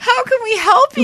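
put-on very high, loud baby talk; baby-talk to dog. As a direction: coo up high like talking to a pet. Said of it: "laughs, voicing owners".

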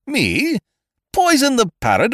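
high, outraged echo of the charge; aghast that anyone could think it; indignant surprise. A narrator's reading. Ukridge protests to his accusing friend, high and outraged.

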